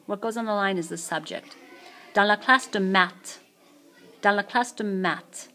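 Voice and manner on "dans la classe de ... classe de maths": "maths" ending on a T sound, no S; silent S. Shown as a demonstration. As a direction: say "maths" like "mat", dropping the S. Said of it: "Don't pronounce the S on maths".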